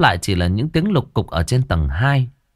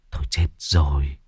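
dismayed dread; 7 semitones lower, 5 dB quieter than usual. Acted. Sudden dread.

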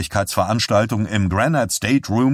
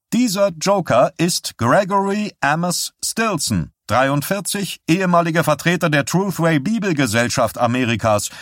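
higher, wry voice introducing a joker; lightly voiced dry reporter, higher; mocking introduction; 8 semitones higher than usual. A narrator's reading. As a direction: sneer a little as you announce the clown. Herman mockingly introduces Stilson.

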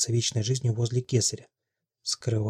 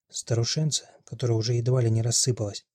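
plain reading voice

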